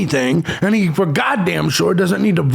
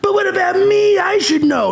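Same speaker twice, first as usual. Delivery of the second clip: very high, crying, whining mock voice; mocking a viewer who demands to be told, high and wailing; mock whiny fan; 12 semitones higher than usual. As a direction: wail it like a spoiled child. Mocking a whiny true crime fan.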